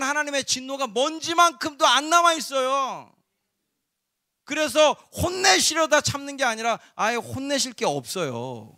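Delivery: high, loud preaching with a shout; full-force preaching. Acted. Preacher proclaiming to the congregation that God's wrath is gone, full-force preaching. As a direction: proclaim it to the whole church.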